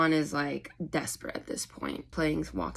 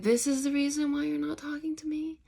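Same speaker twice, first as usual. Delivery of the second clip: disbelieving; 10 semitones higher than usual. Retold or imitated. Won in disbelief.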